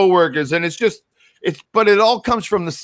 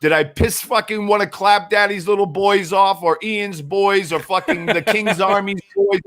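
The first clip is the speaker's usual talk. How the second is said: ordinary talk, nothing marked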